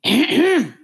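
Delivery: high, loud throaty outburst; short Gryphon throat noise; sudden high rasp in the throat; creature's exclamation. A narrator's reading. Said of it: "an exclamation of".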